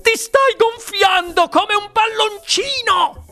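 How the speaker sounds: high, drawn-out alarmed shriek; shrieking mother. A narrator's reading. Mother watches daughter balloon, shrieking. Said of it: "strillò la signora Beauregard".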